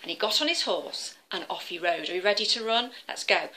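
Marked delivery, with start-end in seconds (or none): none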